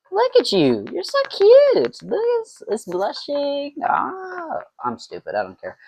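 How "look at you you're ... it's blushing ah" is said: put-on very high, slow baby-talk coo. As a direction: coo at the mead like it's a baby. Cooing at the mead like a baby.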